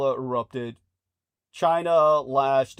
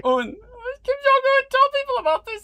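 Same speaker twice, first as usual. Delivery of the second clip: squeaky high voice; 8 dB louder than usual. Pretend.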